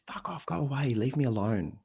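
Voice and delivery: mock upset, pitched high. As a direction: act out pushing someone away, without shouting. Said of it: "your woman's really upset with you".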